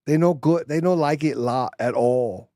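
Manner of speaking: high, slow put-on Chinese accent; mock Chinese accent. Joking about China, in a hammed-up Chinese accent. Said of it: "Did I do that wrong?".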